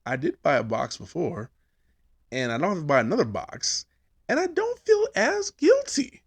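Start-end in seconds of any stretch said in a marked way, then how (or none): 4.3-6.2 much higher, elated; triumphant squeak; gleeful squeak. Real, not acted. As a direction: squeal with relief.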